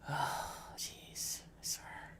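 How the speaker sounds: whispered frustrated sigh; exasperated whisper. Real, not acted. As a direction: breathe it out under your breath. Exasperated at the software.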